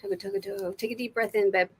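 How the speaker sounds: usual speaking voice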